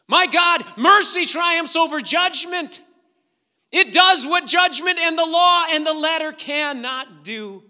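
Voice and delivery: high, loud preacher's cry; loud climactic preaching; preaching at full force. Acted. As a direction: lift the crowd, full force sermon.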